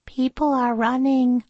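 high-pitched princess voice; high character voice, matter-of-fact remark; Princess Sneezewort. A narrator's reading. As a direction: voice a little storybook princess up high. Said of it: "said Princess Sneezewort".

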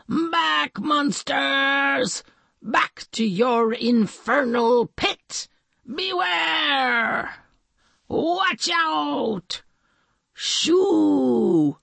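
high, heroic shouted catchphrases. A narrator's reading. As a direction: boom out like a cartoon hero. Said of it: "tried out some catchphrases".

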